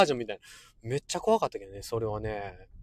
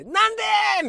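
very high imitation of a sleep-talking cry; mock sudden scream; 4 dB louder than usual. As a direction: burst out, mimicking a child's night terror. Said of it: "いきなりマックス". (いきなり全力で叫ぶ真似)

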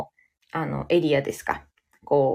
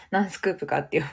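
ordinary delivery

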